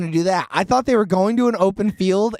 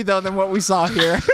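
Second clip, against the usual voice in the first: ordinary delivery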